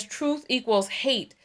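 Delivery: ordinary talk, nothing marked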